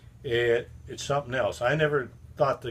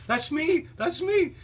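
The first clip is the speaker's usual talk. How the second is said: much higher and louder, thrilled; eager claim; 5 dB louder, slower than usual. Retold or imitated. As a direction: blurt it out, bursting with pride. David eagerly claims his fame.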